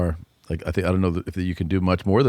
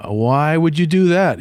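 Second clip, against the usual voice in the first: exasperated; 8 semitones higher, 4 dB louder, slower than usual. Retold or imitated. Mom questioning kid.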